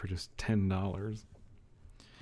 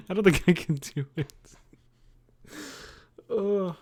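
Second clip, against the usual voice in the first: ordinary talk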